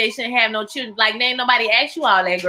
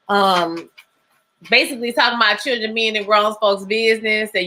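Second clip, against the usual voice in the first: ordinary delivery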